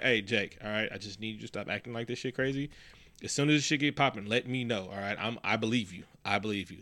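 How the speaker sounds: soft, low, confiding tone; calm reassurance. Pretend. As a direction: play a psychiatrist quietly reassuring a kid. Imagined psychiatrist reassuring a troubled kid.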